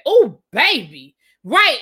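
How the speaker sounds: loud, slow commiserating exclamation; loud exclaim. Real, not acted. Commiserating about heat.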